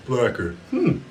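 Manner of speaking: ordinary talk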